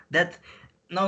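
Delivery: normal talking voice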